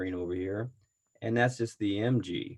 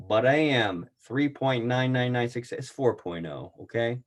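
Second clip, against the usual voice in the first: usual speaking voice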